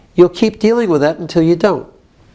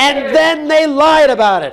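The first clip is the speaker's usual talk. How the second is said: higher-pitched accusation; modelled outrage; 5 semitones higher than usual. Shown as a demonstration. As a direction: lean hard on LIED. Coach models venting.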